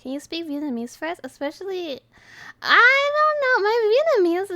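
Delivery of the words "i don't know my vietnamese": flustered. Real, not acted. Embarrassed about Vietnamese.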